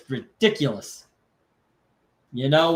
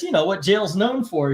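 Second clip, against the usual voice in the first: normal talking voice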